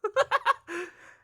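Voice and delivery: high, loud nervous laugh; shrill laugh. Acted. Reacting to fainting client.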